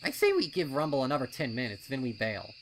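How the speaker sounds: loud, fast, doubting colt voice; a wary colt talking his friend out of it; skeptical colt. A narrator's reading. Snips questioning Pipsqueak's wish to enter, loud and fast.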